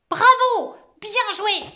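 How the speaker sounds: loud, high congratulations; exclaiming cheer. A narrator's reading. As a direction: cry out in delighted applause. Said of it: "s'écria mademoiselle Pandémonium". (félicitations criées, aiguës et enthousiastes)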